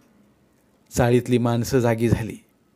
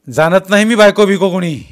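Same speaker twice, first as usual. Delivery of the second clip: loud, higher dismissive yell; angry shout; 7 semitones higher, 10 dB louder than usual. Acted. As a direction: shout him down with contempt.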